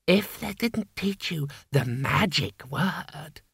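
unbothered and calmly smug; calm gargoyle. A narrator's reading. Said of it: "it said calmly".

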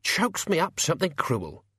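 high, put-on grumble; hammy old grumbler; loud complaint. A narrator's reading. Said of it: "said Albert loudly".

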